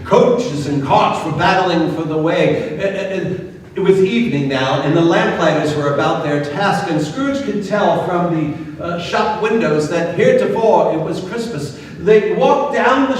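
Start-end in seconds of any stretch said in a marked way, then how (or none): none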